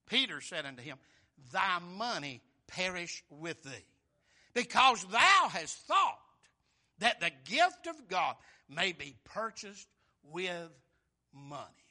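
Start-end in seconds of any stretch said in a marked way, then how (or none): 1.5-11.8 slow, heavy and loud, each word weighed; stern rebuke. Acted. Peter pronouncing judgment on the money-seeker, slow and stern. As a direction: thunder the judgment down on him.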